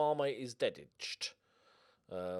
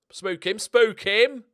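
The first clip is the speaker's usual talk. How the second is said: high, loud, drawn-out egging on; urgent urging shout; 9 semitones higher, 17 dB louder, slower than usual. Real, not acted. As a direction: yell to players to scare him. Urging his players on.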